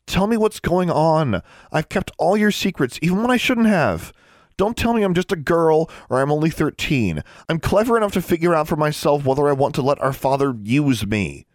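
high-pitched, insistent appeal; pleading. A narrator's reading. Sister begging brother to trust her, pleading. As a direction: plead hard, pitched well up. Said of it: "she pleaded".

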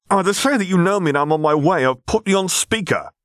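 high, flustered figure's voice; flustered babble. A narrator's reading. Flustered Quinn answers in panic.

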